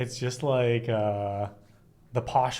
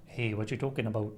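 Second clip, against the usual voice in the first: mock Canadian accent; 2 semitones lower, 7 dB quieter, faster than usual. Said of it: "a Canadian from".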